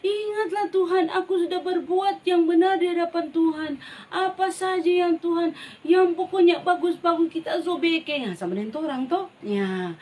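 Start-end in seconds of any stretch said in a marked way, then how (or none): 0.0-8.3 high, wailing tearful plea to God; a king weeping as he prays; crying prayer. Acted. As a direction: sob it out to the Lord. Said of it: "dia berdoa adik-adik sambil menangis".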